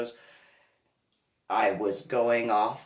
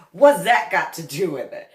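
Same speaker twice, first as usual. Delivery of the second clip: loud, indignant New Jersey retort; mock-angry New Jersey comeback; angry New Jersey accent; 4 semitones higher, 10 dB louder than usual. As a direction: fire back in East Coast Jersey outrage. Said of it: "I can tell you're from New Jersey".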